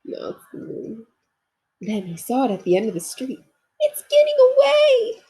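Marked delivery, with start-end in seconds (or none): 3.8-5.2 much higher, louder alarmed cry. Acted. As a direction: shout after the escaping balloon. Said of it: "cried Corduroy".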